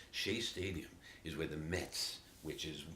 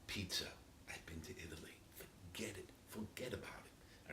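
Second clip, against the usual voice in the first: hushed awe; 9 dB quieter than usual. Real, not acted. Reverent whisper about pizza.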